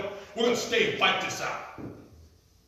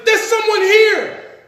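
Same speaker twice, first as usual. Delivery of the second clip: put-on high, slow child's voice; little boy; 12 dB louder, much slower than usual. Little boy finds a stranger in the basement, high slow child voice. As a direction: call it out like a startled kid. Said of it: "The little boy says".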